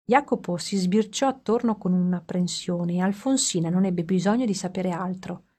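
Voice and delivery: ordinary delivery, nothing marked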